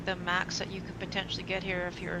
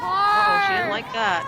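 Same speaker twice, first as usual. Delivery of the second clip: surprised; 12 semitones higher, 10 dB louder than usual. Real, not acted. Commentator reacts to bad shot.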